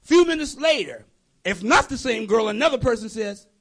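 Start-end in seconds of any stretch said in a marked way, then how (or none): none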